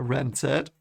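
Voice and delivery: ordinary delivery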